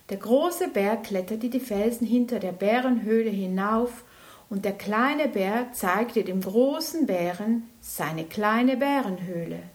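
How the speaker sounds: ordinary delivery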